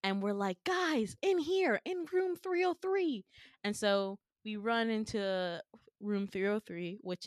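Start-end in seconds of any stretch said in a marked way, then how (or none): 0.6-3.2 high-pitched urgent calling; acted urgent call. Calling friends into room three oh three, urgent and high. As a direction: call them over, pitched well up.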